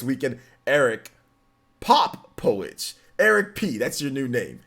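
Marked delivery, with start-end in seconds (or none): 1.8-2.9 Popowich slowly and loudly as "POP... po-witch"; careful corrected pronunciation. Real, not acted. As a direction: hit POP hard in Popowich, then po-witch. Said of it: "but no, it's Popowich".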